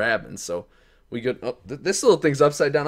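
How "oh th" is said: normal talking voice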